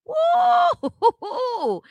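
a loud, high whoop of delight; excited woo shout. Real, not acted. Excited shout during sermon.

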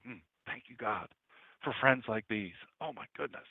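soft, low murmur of gratitude; hushed thankful prayer. Retold or imitated. Preacher thanking God for friends, hushed and grateful. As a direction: quietly overcome with thanks, as in private prayer.

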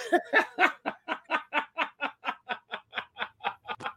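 long high real laugh; big laugh. Real, not acted. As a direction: burst out laughing at the pun. Laughing at the freebie joke.

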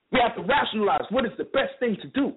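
ordinary talk, nothing marked

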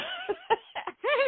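high squealing laugh of disbelief; a real, high delighted squeal of a laugh. Real, not acted. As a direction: laugh out in giddy shock. Thrilled at being picked.

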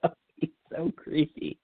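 high-pitched, through real laughter; laughing. Real, not acted. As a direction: squeeze the words out while laughing. Still laughing at creepy behaviour.

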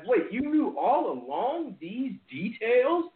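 high, drawn-out mock disbelief; incredulous fans. Fans realising the company knew all along, incredulous. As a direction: act out stunned realisation, pitch swooping up. Fans finding out about cover-up.

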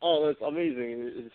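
ordinary talk, nothing marked

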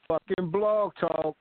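usual speaking voice

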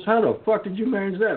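stunned how-did-you; amazed. Retold or imitated. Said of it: "He goes".

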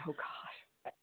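hushed whispered dread; whispering. Real, not acted. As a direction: breathe it out in a whisper. Reacting to horror documentary title.

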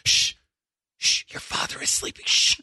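hushing whisper, mimicking wife; imitated wife shushing. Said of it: "She starts off going".

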